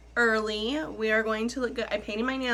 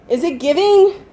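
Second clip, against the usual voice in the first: excited; 7 semitones higher, 10 dB louder than usual. Real, not acted. Repeats question, much higher, louder.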